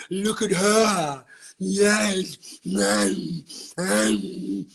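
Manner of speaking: slow, savoring mock monster purring over drama; a hint of a gloating parasite, slow and relishing; pain body voice. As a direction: lick your lips over other people's pain, slowly. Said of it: "the pain body goes".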